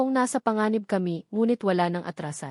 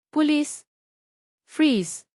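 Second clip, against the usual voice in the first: police shout; 7 semitones higher, 3 dB louder, much slower than usual. Acted. Cop bursts in shouting.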